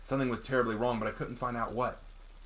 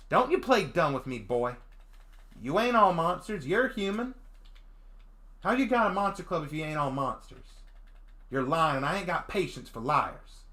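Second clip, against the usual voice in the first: lightly voiced feral Southern girl; rough southern country girl; 8 semitones higher, 4 dB louder than usual. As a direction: interrogate him with a light Southern country accent. Voicing the Dragonute girl.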